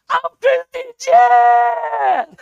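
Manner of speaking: high, loud and slow, bursting with joy; a thrilled girl's shout; excited announcement. Retold or imitated. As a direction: announce yourself like it's the best news ever. Daughter announces herself.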